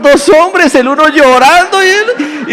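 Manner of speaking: high, drawn-out mock gossip voice; scandalized onlookers. Onlookers at the restaurant seeing two men, mock-scandalized. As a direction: imitate nosy bystanders, hammed up. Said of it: "qué va a pensar la gente".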